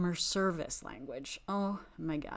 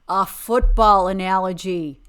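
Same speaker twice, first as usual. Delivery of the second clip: much louder, punch "football"; exasperated repeat; 14 dB louder than usual. Real, not acted. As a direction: repeat it loudly in disbelief.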